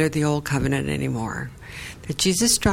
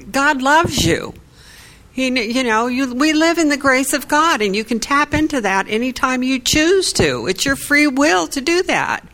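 impassioned retold preaching; 6 semitones higher, 4 dB louder than usual. Preaching to the man after church.

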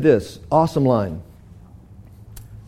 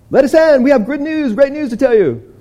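high and loud, shouting up to the guard; excited shout to be let in; calling out at gate; 10 semitones higher, 4 dB louder than usual. Acted. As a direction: call up to the gate.

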